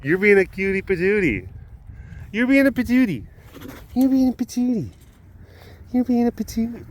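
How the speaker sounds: high, singsong doting voice; cooing pet talk. Real, not acted. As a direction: tease an adored pet in a high coo.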